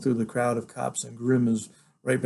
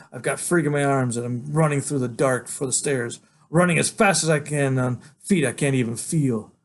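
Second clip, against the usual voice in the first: ordinary delivery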